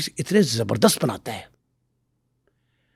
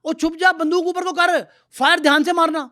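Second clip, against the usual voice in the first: mock gamer shout; 14 semitones higher than usual. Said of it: "اور وہ کہہ رہے تھے".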